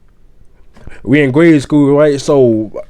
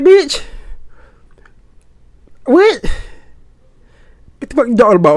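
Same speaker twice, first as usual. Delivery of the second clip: incredulous outburst; 11 semitones higher than usual. Retold or imitated. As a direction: act floored by something absurd. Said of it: "I'm like".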